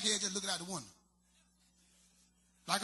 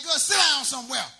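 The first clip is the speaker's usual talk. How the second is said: loud, higher telling-off; mock scolding; 4 semitones higher, 8 dB louder than usual. Comedian telling his high auntie to sit down, loud scolding. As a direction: snap at her to sit down. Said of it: "I'm like".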